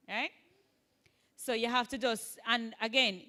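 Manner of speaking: ordinary talk, nothing marked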